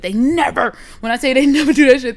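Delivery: NEVER hit hard and loud; emphatic insistence. Real, not acted. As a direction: punch the word never in exasperation. Insisting on neighbors' repeated offence.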